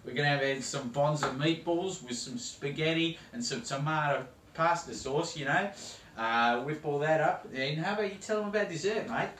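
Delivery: broad ocker Australian accent played for laughs; hammed-up Aussie accent. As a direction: play a cartoon Aussie TV cook. Parody host continues.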